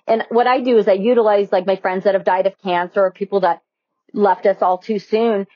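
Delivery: ordinary delivery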